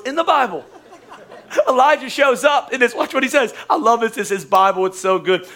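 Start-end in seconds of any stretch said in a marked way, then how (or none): none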